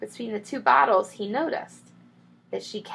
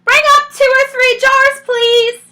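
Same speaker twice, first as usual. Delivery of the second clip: very high, loud call from above; calling back; 13 dB louder than usual. A narrator's reading. Mrs Bean calls back down to the cellar, loud and high. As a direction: call it down the stairs. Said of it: "the other voice called back".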